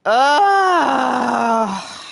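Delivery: long, high, very loud scream; real scream of self-annoyance; annoyed scream. Real, not acted. Player annoyed at not saving, screaming. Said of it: "I'm so annoyed with myself".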